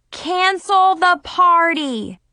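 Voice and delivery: slow, loud and high command; exclaimed. A narrator's reading. The friends tell Nikki, loud slow exclamation. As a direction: blurt it out emphatically. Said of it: "they both exclaimed".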